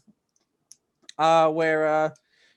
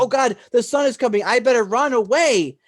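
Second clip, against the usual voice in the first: high, loud retold alarm; mock panic; 8 semitones higher, 7 dB louder than usual. Demon sees the sun coming, loud mock panic. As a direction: exclaim it in hammed-up panic. Acts out demon fleeing sunrise.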